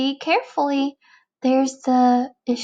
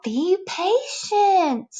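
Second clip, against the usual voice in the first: slow patient reply; 8 semitones higher, much slower than usual. Acted. Other caterpillar, drawn out.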